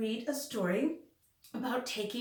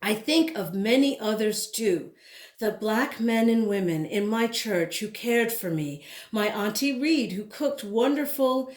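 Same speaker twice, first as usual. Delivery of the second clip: louder, lively storybook reading; reading to children; 7 dB louder than usual. Acted. Teacher reading a picture book aloud to her class, lively storytelling. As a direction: read it out with rhythm and life for the kids.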